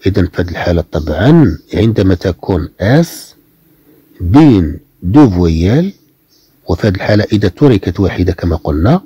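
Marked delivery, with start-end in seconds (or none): none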